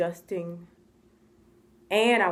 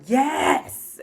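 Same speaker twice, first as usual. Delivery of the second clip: snapped yes, higher and louder; retold yell; 7 semitones higher, 6 dB louder than usual. Dad yelling yes at the au pair who asked, retold yell. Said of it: "when I hear people yelling".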